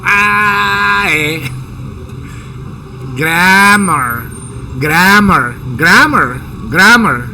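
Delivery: "grammar" with a tight, squeezed A. Shown as a demonstration. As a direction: squeeze the A as if your neck is pinched. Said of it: "le apretan ahí el pescuezo". (A apretada en "grammar")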